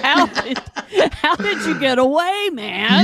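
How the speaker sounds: high, laughing incredulous question; hammed-up disbelief with a laugh; mock amazement. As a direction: play astonished at a friend's escape, through laughter. Imagined amazed reply, laughing.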